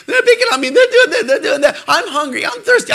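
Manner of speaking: put-on high-pitched whining kid voices, laughing through them; whiny kids. Father mimicking his kids' whining, high and squealy. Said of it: "somebody's always crying".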